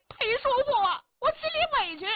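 put-on high boyish voice, hurt and sulky; a young boy close to tears; aggrieved boy. Boy pouring out his hurt to his uncle, high and aggrieved.

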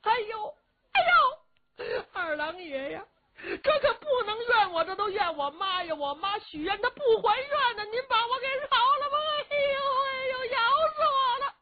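very high, tearful howling; hammed-up sobbing shrieks; wailing cries of pain. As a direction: bawl and beg for mercy as if being bitten. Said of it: "就听里边罗成这喊呐".

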